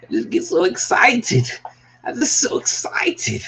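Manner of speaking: high, excited character squeal; Mike Myers. Acted. Creator spoofing a film character for viewers, giddy high squeal. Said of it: "there's always a Mike Myers ref".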